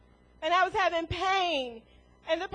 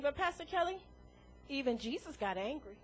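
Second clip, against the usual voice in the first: softer, mumbled objection from the pews; imagined listener objection; 4 semitones lower, 12 dB quieter than usual. Retold or imitated. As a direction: quietly voice the doubting churchgoer. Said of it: "you sitting out there saying".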